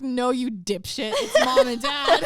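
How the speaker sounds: slow, high, loud mock yell; freaked-out sister. Sister breaking the tooth fairy truth to her brother, exasperated mock yell. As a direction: hammer it out, rising to a shout. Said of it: "Claire freaks out".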